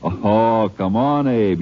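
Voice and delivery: ordinary delivery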